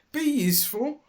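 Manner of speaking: plaintive. A narrator's reading. Dilly doubts herself in the shed, plaintive high. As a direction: say it as a doubtful hope. Echoed later triumphantly.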